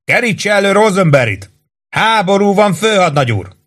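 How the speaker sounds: high-pitched yell from the rooftop. A narrator's reading. Sergeant on the roof yelling at the lieutenant below. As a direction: bellow the order up the stairs. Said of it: "ordította Warden".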